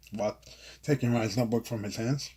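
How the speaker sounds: plain reading voice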